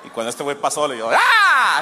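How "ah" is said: a sudden loud "boo" shout, much higher; sudden scare shout. Retold or imitated. Scaring the chihuahua in hide and seek, a sudden loud shout. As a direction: jump out and yell.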